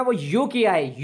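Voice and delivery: वो यू की है drawn out slowly; slow explanation. Shown as a demonstration. Teacher slowing down to explain the sound. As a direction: stretch वो यू की है so the class hears it. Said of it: "इसकी आवाज़ है ना".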